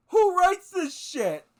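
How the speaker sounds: high, loud and slow disbelief; who on earth writes this; incredulous outburst. Real, not acted. Incredulous at the message.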